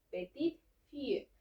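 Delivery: "petite fille" with the T of "petite" clearly pronounced; sounded final T. Shown as a demonstration. Teacher gives word for granddaughter, "petite fille" with audible final T. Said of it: "Aquí sí vamos a pronunciar la T".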